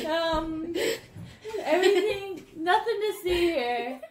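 high, laughing, near tears. Real, not acted. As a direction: barely get it out for laughing.

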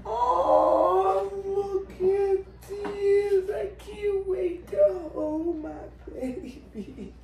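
high, slow, tearful cry; choked up with happy tears; crying with joy. Real, not acted. Overcome with joy.

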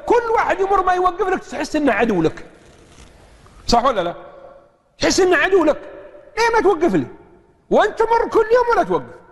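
high, pleading voice of a stranded driver; stranded man's high aggrieved plea; mock complaint. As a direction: whine it like a man nobody stops for.

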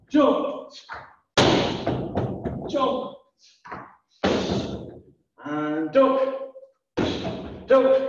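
calling out moves. Acted. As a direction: bark each move like a fitness coach.

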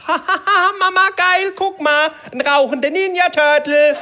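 high, loud mocking child voice, laughing; cheeky kid. Little boy calls out to his mother, high and loud. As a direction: squeal it like a giggling little boy. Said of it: "kommt so ein kleiner Frecker vorbei mit seiner Mutter und sagt".

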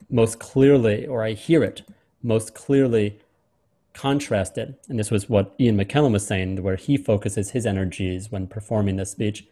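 usual speaking voice

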